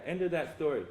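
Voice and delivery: usual speaking voice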